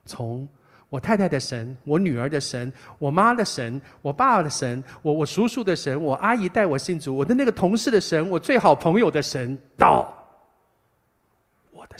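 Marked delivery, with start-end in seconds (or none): none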